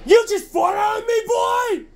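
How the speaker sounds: high outraged yell; outraged shout. Acted. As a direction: shout in disgust. Outraged at the cat.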